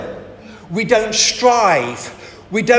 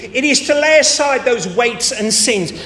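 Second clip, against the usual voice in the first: ordinary talk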